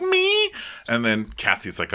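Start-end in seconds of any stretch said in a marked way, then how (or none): 0.0-0.5 very high squeak of fake shock; mock surprise. Host mocking Kyle's high squeak of fake shock. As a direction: squeak it out, feigning innocence. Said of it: "She's like".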